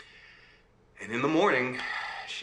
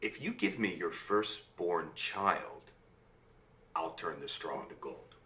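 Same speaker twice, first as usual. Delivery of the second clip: imp's sly bargain; 6 semitones lower, 6 dB quieter than usual. Acted. And the imp says.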